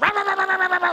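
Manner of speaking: high, loud barking rah rah; imitated dog barking. Preacher imitates the charging dog barking. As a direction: bark like a charging guard dog.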